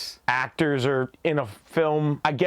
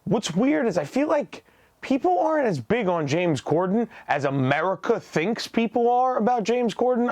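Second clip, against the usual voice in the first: ordinary talk, nothing marked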